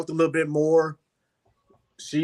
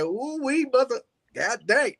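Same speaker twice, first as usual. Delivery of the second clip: quick playful catchphrase delivery; 7 semitones higher than usual. Retold or imitated. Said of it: "[name] has a saying, he be like".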